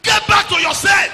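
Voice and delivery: high, loud and slow; slow shouted rebuke; shouted command. Real, not acted. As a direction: call them back with force. Preacher commanding congregation.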